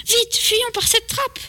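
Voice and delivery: très aigu, lent et appuyé; fuite pressante. Acted. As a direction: pousse l'autre à fuir, haletant d'urgence. Boys flee the attacker. (exclamation aiguë et traînante)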